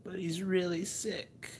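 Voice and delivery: high, slow dreamy sleep-talk; imitating wife sleep-talking. Husband imitating his wife talking in her sleep, high and slow. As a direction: sadly mumble it half asleep. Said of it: "She's like".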